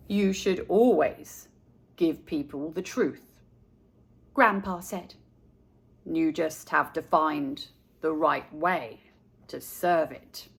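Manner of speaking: a calm grandpa explaining himself to his grandson; gentle grandpa. A narrator's reading. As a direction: settle the boy down like a patient grandfather. Grandpa voiced in the story.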